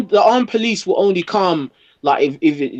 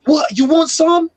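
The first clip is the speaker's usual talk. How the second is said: much higher, confrontational jab; mock aggressive cop; 11 semitones higher than usual. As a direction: imitate a cop itching for a fight. Aggressive cop at party, retold.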